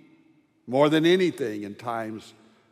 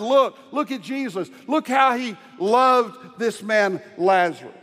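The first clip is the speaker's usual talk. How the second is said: raised, awed voices pointing at Jesus; awed crowd; 8 semitones higher, 4 dB louder than usual. Acted. Onlookers at the tomb marvel at his grief, raised and awed. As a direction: marvel aloud at his love.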